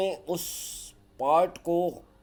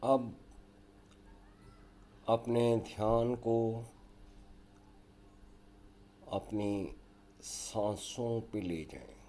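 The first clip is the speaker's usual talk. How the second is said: guided yoga nidra; 5 semitones lower, 8 dB quieter than usual. Acted. Leads a yoga nidra relaxation.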